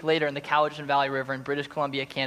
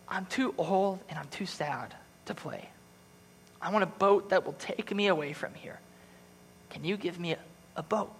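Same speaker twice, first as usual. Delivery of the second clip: weary and sad; 3 semitones higher, 5 dB quieter than usual. Acted. Old boy asking tree for a boat, quiet and weary. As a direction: let the words sag with sadness.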